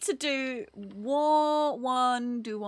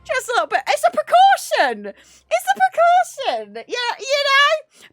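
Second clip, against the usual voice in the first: squeaky mock voice; 13 semitones higher, 8 dB louder than usual. Jokey precaution bit, high voice.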